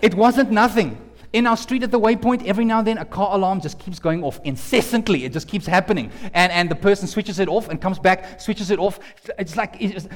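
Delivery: usual speaking voice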